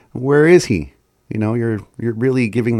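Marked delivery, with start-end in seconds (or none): 0.1-0.9 "Where is he?" higher and louder, not calm; anxious "Where is he?" with a lift on "is"; emphatic question. Shown as a demonstration. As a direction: press "Where is he?" like you need the answer. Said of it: "You're more like".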